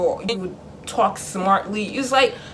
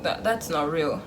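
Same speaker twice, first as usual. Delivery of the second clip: usual speaking voice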